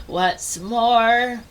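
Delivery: ordinary delivery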